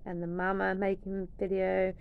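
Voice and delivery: ordinary talk, nothing marked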